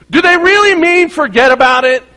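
loud, high-pitched rhetorical doubt; incredulous question. Real, not acted. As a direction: pitch it way up in mock disbelief.

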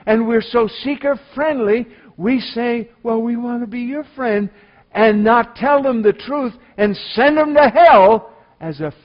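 loud, high-pitched preaching at full force; fiery sermon delivery. Acted. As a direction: thunder it from the pulpit like a revival preacher.

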